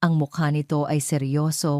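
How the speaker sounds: ordinary delivery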